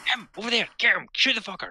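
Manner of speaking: high, eager yelling at the screen; excited urging. Retold or imitated. Wife spotting targets in the sniper game, high excited yells. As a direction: point and shout like she can't wait. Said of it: "she's like".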